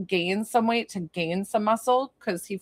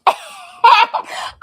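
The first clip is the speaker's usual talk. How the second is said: high, loud helpless laugh bursting out; burst of laughter; 9 dB louder than usual. Real, not acted. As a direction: crack up out loud. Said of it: "I'm laughing so hard".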